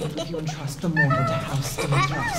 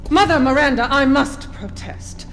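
raised, loud, stately objection; high, measured indignation; indignant protest; 5 semitones higher, 4 dB louder than usual. Acted. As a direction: object grandly to the leader. Noblewoman objects to the leader's decision.

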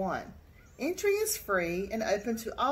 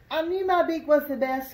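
high, loud boastful squawk; proud squawk; 7 semitones higher, 6 dB louder than usual. A narrator's reading. Spoonbill boasting to the other birds, loud proud squawk. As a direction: crow about his beak in a higher, louder voice. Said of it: "squawked the spoonbill".

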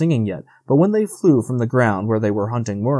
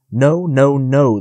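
louder, drawn-out refusal in narration; a storyteller's mild howling dog; 3 semitones higher, 6 dB louder, slower than usual. A narrator's reading. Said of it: "the dog howled".

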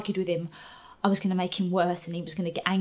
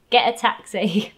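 louder, curt imitation of mum; mum's curt order; 3 semitones higher, 9 dB louder than usual. Mum on the phone to returning daughter, curt. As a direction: cut it short, loudly dismissive.

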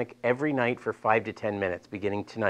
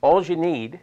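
Delaware accent; 5 semitones higher, 5 dB louder, slower than usual. Real, not acted. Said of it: "that's my Delaware accent coming out".

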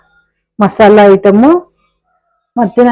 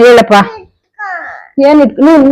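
normal talking voice

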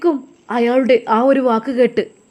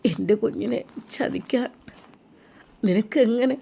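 softer, tearful accusation; crying reproach; 5 dB quieter than usual. Acted. Weeping father accuses Ajmal of betraying his child, softly tearful. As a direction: choke out the blame through tears. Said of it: "Arabi കരയുകയായിരുന്നു".

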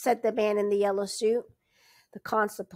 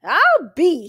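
loud, higher burst of surprise; amazed; 7 semitones higher, 12 dB louder than usual. A narrator's reading. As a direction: constable learns the Fosters sold their land, amazed exclamation. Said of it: "The constable's eyes went round".

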